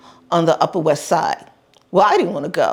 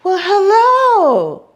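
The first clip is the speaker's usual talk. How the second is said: high, slow syrupy hello; sudden charm at the child; sweet-talking man; 13 semitones higher, 4 dB louder, much slower than usual. Retold or imitated. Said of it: "he said".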